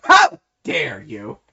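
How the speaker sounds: loud purse-clutching outrage, high yelp dropping into slow indignation; hammed-up shocked gasp of offence, drawn out; Terriarch, scandalized. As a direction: act hugely affronted, first word shrieked high. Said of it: "clutching his purse going".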